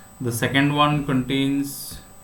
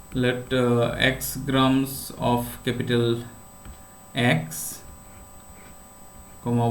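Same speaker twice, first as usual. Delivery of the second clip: dictating; 4 dB quieter than usual. Real, not acted. Teacher dictating notation to students.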